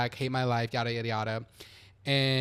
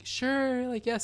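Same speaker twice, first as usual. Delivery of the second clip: small, higher-pitched yes; retold meek yes from a child who can't say no; reluctant agreement; 8 semitones higher, 4 dB quieter, much slower than usual. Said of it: "had a hard time saying no".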